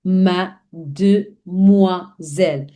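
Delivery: Mademoiselle drawn out, falling at the end; very slow model. Shown as a demonstration. Teacher drawing out Mademoiselle before breaking it down. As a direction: stretch Mademoiselle slowly for learners. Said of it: "let's break it and understand".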